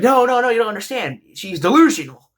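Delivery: high, loud panicked protest; flustered denial. Retold or imitated. Main character protesting to grandma.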